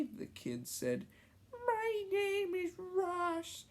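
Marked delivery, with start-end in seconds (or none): 1.5-3.7 put-on high, slow child voice; kid voice. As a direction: speak as a small boy. Ross kid voice.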